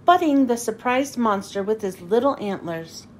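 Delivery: usual speaking voice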